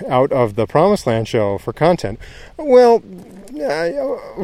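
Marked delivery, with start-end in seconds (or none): 2.6-4.4 high squeaky well. Real, not acted. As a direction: pitch leaps up sharply on well. High squeak before revealing.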